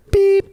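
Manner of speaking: loud, high bleep tone; censor beep. Pretend. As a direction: cut in with a sharp high beep. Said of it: "You're supposed to beep me".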